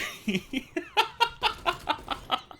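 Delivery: squeaky high burst of laughter. Real, not acted. Telling viewers the book is borderline pornography, laughing high.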